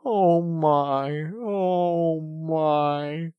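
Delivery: high, loud, drawn-out moaning; miserable. A narrator's reading. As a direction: moan in self-pity. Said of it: "said the mole miserably".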